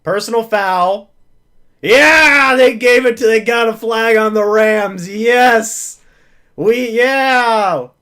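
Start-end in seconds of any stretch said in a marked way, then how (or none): 1.8-7.9 loud, happy yell; thrilled shout. Real, not acted. Fan cheering the flag on the Rams, loud and joyful. Flag goes his team's way.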